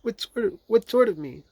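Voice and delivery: high character-voice question; querying Rabbit. A narrator's reading. Hidden Rabbit checking who is outside, querying. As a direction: probe in a high storybook voice.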